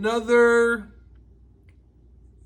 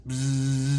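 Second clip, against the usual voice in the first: low, soft bee buzzing; 8 semitones lower, 5 dB quieter than usual. Shown as a demonstration. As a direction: buzz like a bee. Imitates bee buzz.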